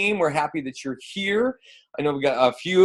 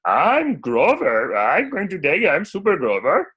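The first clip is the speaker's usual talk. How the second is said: Grover; 4 semitones higher than usual. Shown as a demonstration. Host showing Frank Oz voices, Grover impression. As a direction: do a proud Muppet superhero impression. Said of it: "he's actually pretty close to Grover".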